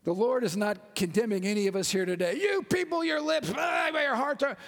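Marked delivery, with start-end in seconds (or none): 2.4-4.6 high, shouted fake scolding; hammed-up ranting at the congregation; mock condemnation. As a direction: pretend to rail at sinners, pitched high.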